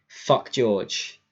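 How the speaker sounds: ordinary talk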